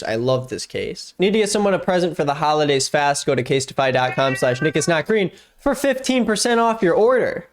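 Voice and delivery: upbeat sponsor read. Acted. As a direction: sell it, bright and eager.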